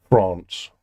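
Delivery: posh "France" with a long broad ah; "France" rhymed with "lance", posh. Shown as a demonstration. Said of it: "That's how the posh people say it".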